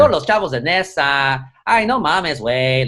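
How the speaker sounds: sing-song Mexico City Spanish; Mexico City accent. Shown as a demonstration. Showing host the Mexico City accent, sing-song. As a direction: demonstrate an exaggerated chilango lilt. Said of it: "do it as a Mexico City accent".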